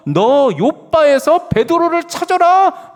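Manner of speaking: God thundering his command; booming voice of God. Acted. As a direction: proclaim it like a divine command from on high.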